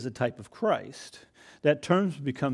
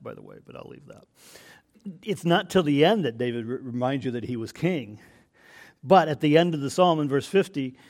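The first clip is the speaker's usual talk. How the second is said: ordinary talk, nothing marked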